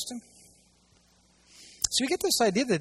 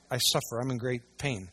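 usual speaking voice

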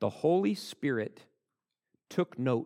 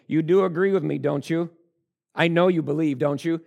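higher, louder insistent pleading; acted Paul; 6 semitones higher, 7 dB louder than usual. Paul pressing the king to agree, loud and insistent. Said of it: "He's like".

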